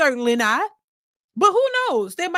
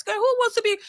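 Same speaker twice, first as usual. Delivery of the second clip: high, sassy mock disbelief; sharp high "girl, please" scoff; incredulous sass; 10 semitones higher than usual. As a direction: toss it off like a scoffing girlfriend. Mocking disbelief at the idea.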